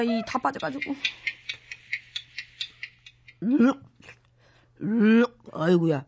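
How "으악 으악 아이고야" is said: retching. Acted. Retching from diet pills.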